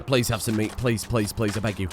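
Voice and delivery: a gamer's half-serious begging at the screen; mock pleading. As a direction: plead quietly with a stubborn pawn. Pleading with colonist to eat.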